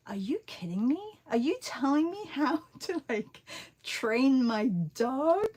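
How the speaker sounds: retold disbelief, pitch climbing as it builds; incredulous indignation. Retold indignant reaction to the husband.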